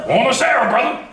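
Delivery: laughing, loud, high mock Italian accent. Mocking classmates' Italian greeting, laughing Italian accent. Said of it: "imagine that Italian accent".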